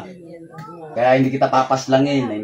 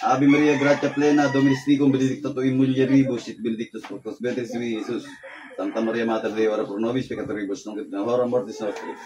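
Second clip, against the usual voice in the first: softer, rapid Latin prayer chant; murmured incantation; 2 semitones lower, 7 dB quieter than usual. Acted. Faith healer murmuring prayers and commands to dissolve the illness.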